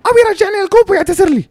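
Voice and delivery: shrieky high caricature voice; mock defiant woman. Woman demanding an apology before the cop, high mock defiance. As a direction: squeal demands in a put-on high voice. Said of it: "and she's like".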